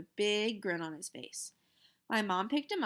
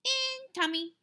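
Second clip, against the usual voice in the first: put-on very high little-kid voice; lightly voiced toddler answering his brother; slower than usual. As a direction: squeak it like a toddler.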